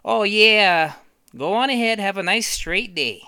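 a hint of a jolly officer; breezy cop. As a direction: wave him on cheerfully. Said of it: "the cop's probably like".

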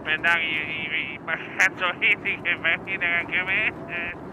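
ordinary delivery

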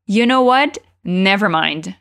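You know what? rising at the end, Never mind falling and low; teacher modelling the tune of You know what? Never mind; rising question, falling dismissal. Shown as a demonstration. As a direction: lift what, then drop Never mind low and flat. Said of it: "presta atenção na entonação aqui dessa frase".

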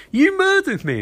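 high, bright outburst of mock glee; a laughing, sky-high accusation. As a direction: pitch it way up like a gleeful gotcha. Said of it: "Sisko just laughs and goes".

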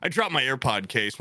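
normal talking voice

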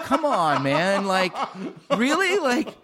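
high, fed-up mock protest at strangers; exasperated. Retelling his exasperation at strangers who call him Jolo, high mock protest. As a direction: act annoyed at people who get your name wrong. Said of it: "I'm like".

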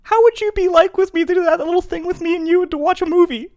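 helium voice. Real, not acted. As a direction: ask it still high on helium. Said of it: "I just hit a little helium".

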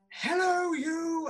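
yelled greeting. Retold or imitated. Master greeting everyone he meets, yelling. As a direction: yell the greeting out at the Doctor. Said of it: "And he yells".

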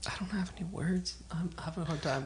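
breathy whisper under the talk. Real, not acted. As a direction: whisper it so nobody hears. Whispering aside.

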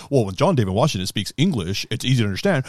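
mocking dumb voice. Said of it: "'Cause if I said".

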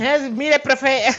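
voz de niño mucho más aguda y pícara; alumno travieso. Acted. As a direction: como chamaco presumiendo al profe. (alumno travieso llamando al profe de educación física, agudo)

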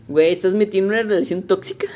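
fast words through real laughter; giggling disbelief at the joke; laughing. Real, not acted. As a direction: laugh while blurting the question. Laughing at the scene.

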